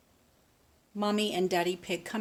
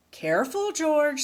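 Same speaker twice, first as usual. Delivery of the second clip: Daddy Pig's warning; 8 semitones higher, 7 dB louder than usual. Acted. Daddy Pig warns George.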